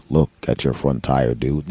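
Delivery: low, slow, spooky dude voice; deep, dragged-out warning from nowhere; mysterious stranger. Retold or imitated. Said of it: "walks around the parking lot".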